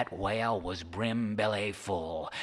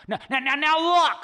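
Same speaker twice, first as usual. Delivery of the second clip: much higher, loud pleading; high cranky codger protesting; flustered Charlie; 7 dB louder than usual. Acted. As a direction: hurry to calm him. Charlie placating old man.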